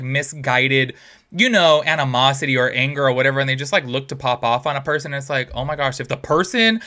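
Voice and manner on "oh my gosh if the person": normal talking voice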